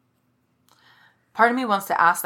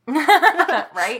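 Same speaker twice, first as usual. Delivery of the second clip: laughing out loud, higher and louder; 9 semitones higher, 10 dB louder than usual. Real, not acted. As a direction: laugh through the word. Laughing reply.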